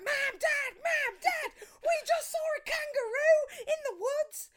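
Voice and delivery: very high, loud breathless calling; excited kids calling. Retold or imitated. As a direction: yell for the parents in a rush. Kids running to tell parents.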